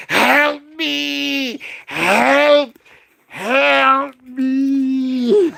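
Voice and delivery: high, wailing cries for help; hammed-up dying wail; melting witch crying. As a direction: beg like a witch dissolving into a puddle.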